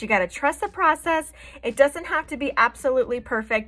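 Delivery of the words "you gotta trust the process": bright, higher pep-talk line; upbeat catchphrase. Real, not acted. Makeup artist reassuring viewers, upbeat and higher. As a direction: cheer them on to trust it.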